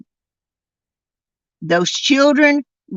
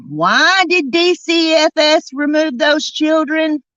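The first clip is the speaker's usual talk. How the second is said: raised, demanding question; angry shout; 6 semitones higher than usual. Real, not acted. Confronting the mother, an angry raised demand. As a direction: demand an answer from her.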